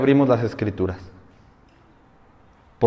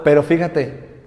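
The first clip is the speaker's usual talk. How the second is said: ordinary talk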